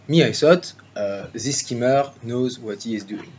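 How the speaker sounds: put-on chauvinist Frenchman in a thick French accent; mock French accent, speechifying to ladies and gentlemen. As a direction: speak English as a proud French politician would, with a French accent. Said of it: "it's going to be in this accent".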